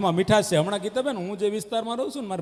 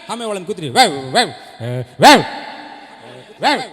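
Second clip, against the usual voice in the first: loud barking wow; mock dog barks; 7 dB louder than usual. Dog barking at the singer, mock bark. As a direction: bark at the singing.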